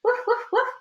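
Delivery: very high yappy little barks; high excited dog yaps; yapping dog. A narrator's reading. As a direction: yap like an excited small dog.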